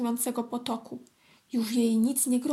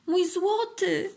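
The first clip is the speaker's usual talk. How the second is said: high, drawn-out delighted hail; joyful call; 7 semitones higher, 4 dB louder, much slower than usual. A narrator's reading. Ant spots a water beetle, calls joyfully. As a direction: stretch the call out, high and happy. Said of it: "zawołała uradowana".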